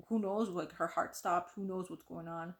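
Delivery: usual speaking voice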